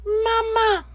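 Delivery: very high, piping chick call; baby gosling cry. Acted. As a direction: squeak it like a newborn gosling. Goslings calling the bear.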